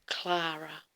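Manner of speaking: "Clara" with an open "ah", as in Klah-ra; "Clara" with a broad first vowel; original pronunciation. Shown as a demonstration. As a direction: say "Clara" as KLAH-ra, level and deliberate. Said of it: "changed the spelling and made it".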